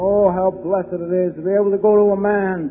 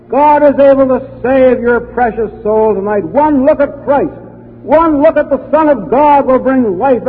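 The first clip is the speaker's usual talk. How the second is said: louder, raised preacher's appeal; full-force preaching; 3 semitones higher, 4 dB louder than usual. Acted. Preacher urging the room to trust Christ, fervent.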